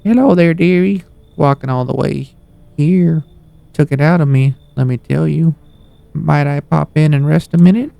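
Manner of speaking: put-on high old lady voice. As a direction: play a sweet meddling granny. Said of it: "she's kinda like old lady".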